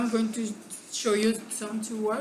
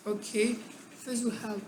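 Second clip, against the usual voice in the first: normal talking voice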